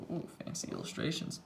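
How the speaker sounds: whisper. Real, not acted. Whispered aside while reading.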